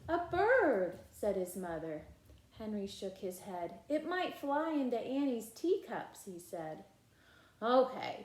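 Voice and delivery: put-on lively read-aloud voice for children; animated storybook reading with character lines; story narration. A narrator's reading. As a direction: read it like a bedtime picture book. Reading picture book aloud.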